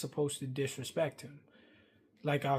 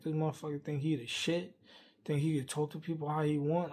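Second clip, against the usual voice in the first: ordinary talk, nothing marked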